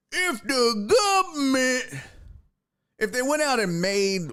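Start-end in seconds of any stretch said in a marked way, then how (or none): 0.1-2.0 high, hammed-up folksy drawl on "government"; exaggerated drawled "gubmint". Host clowning about the government, high exaggerated folksy drawl. Exaggerated repeat of same words.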